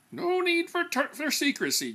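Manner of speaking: mild mock reprimand; scolding. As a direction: scold them for being secretive. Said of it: "J P scolded".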